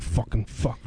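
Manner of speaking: low, soft muttered curse; muttering. Retold or imitated. Ventriloquist cursing to himself at the bar, imitated muttering. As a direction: mutter it under your breath. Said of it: "muttering to himself".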